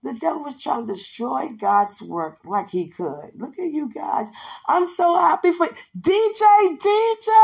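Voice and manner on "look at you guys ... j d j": excited gushing. Retold or imitated. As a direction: gush with joy, high and stretched. Friend gushing retold.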